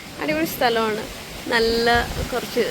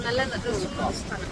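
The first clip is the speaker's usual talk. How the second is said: very soft, drawn-out murmur; soft slow murmur; 11 dB quieter, much slower than usual. Real, not acted. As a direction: breathe it out slowly, almost to yourself. Repeats the phrase twice.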